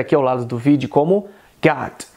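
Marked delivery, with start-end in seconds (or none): 1.6-2.1 American accent. Shown as a demonstration. Teacher giving the American version, American accent. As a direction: say "got" in American English. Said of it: "no inglês americano".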